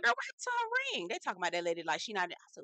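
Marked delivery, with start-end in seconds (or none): 0.0-1.1 high, quick imitation of a nosy friend; nosy gossip. Friend asking about the lady's missing ring, high nosy gossip.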